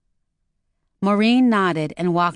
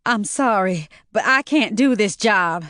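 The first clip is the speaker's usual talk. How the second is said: mild whimper; 4 semitones higher than usual. Acted. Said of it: "Maureen whimpered".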